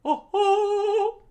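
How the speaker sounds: very high, loud squeal; excited character. Acted. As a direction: shriek it up high.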